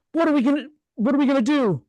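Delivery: high breathless panic; panicked. Acted. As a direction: lose control in fear. [name] voicing Freddy's panic.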